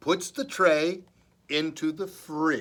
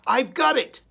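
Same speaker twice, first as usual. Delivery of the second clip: higher and louder eureka; excited idea; 9 semitones higher, 8 dB louder, faster than usual. Acted. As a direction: burst out with the idea. Pete's sudden idea.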